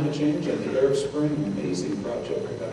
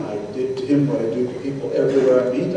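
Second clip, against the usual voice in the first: ordinary talk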